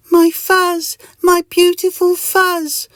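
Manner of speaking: very high, loud, slow lament; hammed-up squealing grief; anguished wail. Mister Itch loses his beautiful fuzz, high anguished wail.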